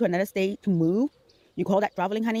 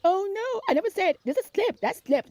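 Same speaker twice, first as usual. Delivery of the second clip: high-pitched mocking excuse voice; hammed-up "oh no" in a higher voice; mock protest; 12 semitones higher than usual. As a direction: imitate someone whining a denial.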